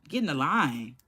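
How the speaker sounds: low command. Retold or imitated. Dad ordering little kids to line up, low command. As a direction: repeat dad's order quietly, pitch dropped. Father's order repeated, much lower.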